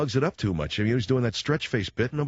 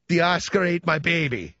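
higher-pitched mock Australian accent; Meryl Streep's dingo accent; 4 semitones higher than usual. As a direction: wail it in a put-on Australian accent. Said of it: "do that dingo accent".

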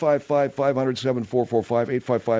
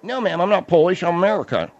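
put-on louder mumbling accent, American dropped to Ameriker; odd mumbled accent; 6 semitones higher, 5 dB louder than usual. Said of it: "that whole accent".

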